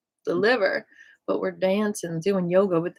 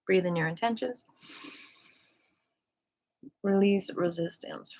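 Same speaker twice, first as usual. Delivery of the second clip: guided meditation; 3 dB quieter than usual. Acted. Leads a breathing meditation.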